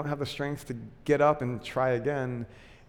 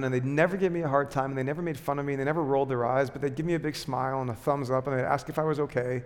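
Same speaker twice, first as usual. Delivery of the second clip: ordinary delivery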